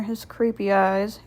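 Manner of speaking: normal talking voice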